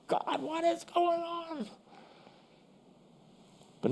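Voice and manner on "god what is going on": much higher, slow mock anguished cry; desperate cry to God. Preacher acts out a believer at breaking point, anguished cry. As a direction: throw it up to heaven at the breaking point. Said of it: "And then I'm like".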